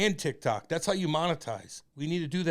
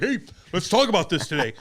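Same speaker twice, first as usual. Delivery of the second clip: mock dad voice; 4 semitones higher, 9 dB louder than usual. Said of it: "you'll be like".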